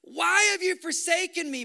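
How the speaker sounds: a pleading shout of abandonment; pleading cry. Acted. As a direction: cry it up to heaven. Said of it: "He pleaded and he said".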